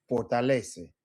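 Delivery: fortalece said for-ta-le-ce, four clear syllables; Spanish fortalece. Shown as a demonstration. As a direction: give each vowel of fortalece its time. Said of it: "four syllables".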